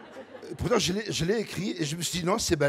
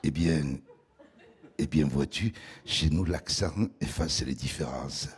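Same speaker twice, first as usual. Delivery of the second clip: deep velvety southern voice; 12 semitones lower than usual. Pretend. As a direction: put on a deep velvet voice, like a charmer. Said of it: "il faut prendre un ton chaleureux, grave, hein, un velouté". (voix grave et veloutée, ton chaleureux)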